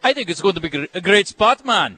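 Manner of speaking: a cheesy Jamaican lilt on the ad; mock Jamaican accent. Host praising the ad in a mock Jamaican accent. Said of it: "your Jamaican accent".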